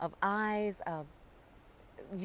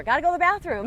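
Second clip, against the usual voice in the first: playful quote; 8 semitones higher, 8 dB louder, faster than usual. Retold or imitated. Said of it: "the sign language for".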